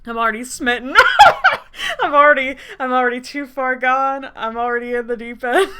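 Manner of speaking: loud, giddy laughing confession. Real, not acted. Reader already smitten with the character, really laughing. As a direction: laugh loudly through the words, helplessly smitten.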